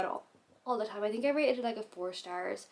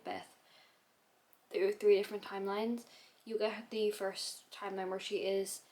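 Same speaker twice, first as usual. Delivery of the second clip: ordinary talk, nothing marked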